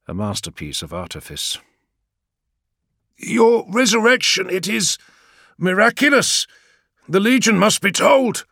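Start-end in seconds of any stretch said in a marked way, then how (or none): 3.2-8.5 high, loud astonished exclaim; amazed warrior. Acted. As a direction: burst out in disbelieving wonder. Gargo amazed by Vulcan's return.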